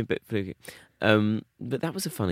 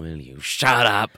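mock shout; 6 dB louder, slower than usual. Mock angry neighbour yelling.

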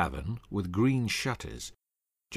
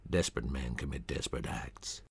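much lower, softer menacing voice; low menacing threat; 10 semitones lower, 10 dB quieter than usual. Acted. Doan to Humphreys, low and menacing. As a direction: let the threat sink low and cold.

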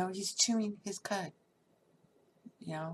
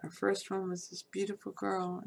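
ordinary talk

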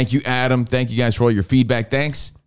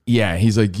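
normal talking voice

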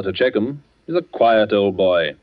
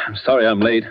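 ordinary talk

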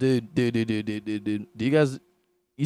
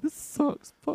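high, softer whiny griping; mock whine pitched way up; mock complaining America; 13 semitones higher, 5 dB quieter, slower than usual. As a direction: imitate a petulant moaner. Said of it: "then America's like".

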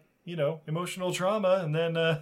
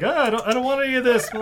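flustered protest; 10 semitones higher, 7 dB louder, much faster than usual. Retold or imitated. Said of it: "he's like".

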